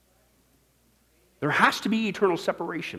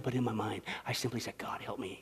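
low, hushed plea; very soft, retold cry for help; imitated wordless prayer; 4 semitones lower, 11 dB quieter than usual. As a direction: nearly whisper a dying man's silent prayer. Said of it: "I couldn't even speak the words".

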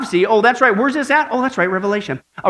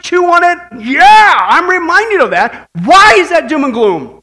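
fervent preaching; 5 semitones higher, 6 dB louder than usual. Acted. Preaching builds in fervor.

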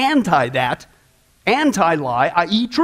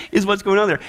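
ordinary talk, nothing marked

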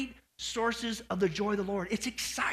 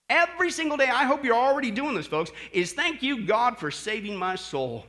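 usual speaking voice

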